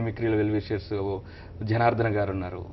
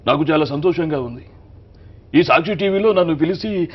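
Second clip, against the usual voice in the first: higher, louder grand formal greeting; Balakrishna on TV; 6 semitones higher, 8 dB louder than usual. Retold or imitated. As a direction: thank the TV channel like the star himself. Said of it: "ఆ విధానం వేరే విధంగా ఉంటుంది".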